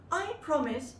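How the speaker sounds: put-on high bright child's promise; sweet little girl promising. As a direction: chirp it like an eager little girl.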